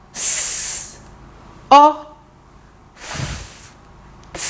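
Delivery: segmenting S, O, F, T; "S" and "F" hissed, "O" voiced, soft "T"; sounds said apart. Shown as a demonstration. Teacher sounds out the word for the class, one sound at a time. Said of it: "Let's go".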